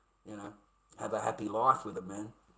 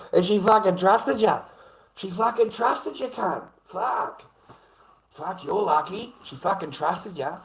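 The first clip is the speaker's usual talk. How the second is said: angry disbelief; 5 semitones higher, 6 dB louder, slower than usual. Real, not acted. Angry at commenter.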